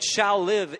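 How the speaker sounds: loud pointed "shall live"; stressed shall live. Shown as a demonstration. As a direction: underline "shall" as the future tense. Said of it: "you at least should know that".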